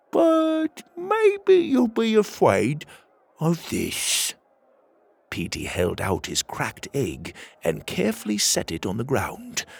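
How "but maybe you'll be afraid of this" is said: much higher, slow, teasing; sly threat. Acted. Petey teasing Tuga about the egg, slow and much higher. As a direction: dangle the surprise slowly. Petey springs his surprise.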